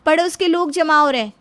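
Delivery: loud, high reprimand; sharp scolding. Acted. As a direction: scold him to stop the racket. Wife scolds husband's noisy celebration.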